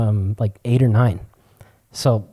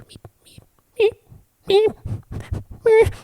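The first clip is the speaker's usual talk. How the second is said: imitated whimpering; slower than usual. Puzzled storyteller mimicking the sound he heard, whimpering meeps. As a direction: squeak out small, spaced whimpers. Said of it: "like, whimpering sound".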